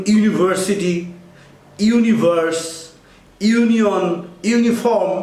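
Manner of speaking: ইউনিভার্সিটি begun with a clear yu; slow careful example words opening on yu; teacher spelling out the yu sound; yu sound demo. Shown as a demonstration. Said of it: "ইউ উচ্চারণ হলে".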